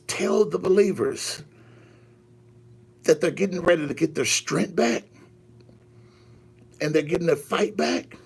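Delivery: measured declarations with long pauses; prophetic preaching. Acted. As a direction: deliver God's promise phrase by phrase. Delivers God's message in pauses.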